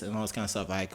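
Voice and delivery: ordinary talk, nothing marked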